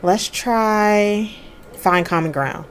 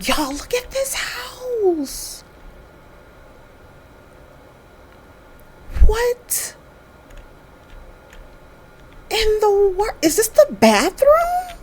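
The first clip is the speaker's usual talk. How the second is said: high-pitched, stretched-out squeal; shocked disbelief; 12 semitones higher, 3 dB quieter, slower than usual. Real, not acted. Shocked reaction to game scene.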